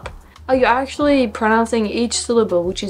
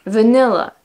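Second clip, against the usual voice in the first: usual speaking voice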